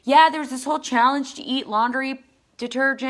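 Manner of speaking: high, loud imitation of a child; kid explaining. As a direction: mimic a kid eagerly explaining. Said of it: "then the kid who was telling about it is like".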